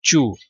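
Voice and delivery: चू with a "ch" sound for the T; British "chu". Shown as a demonstration. Teacher showing the British first syllable, चू with ch. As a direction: say चू like "chew", British style. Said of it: "जो British accent है उसमें".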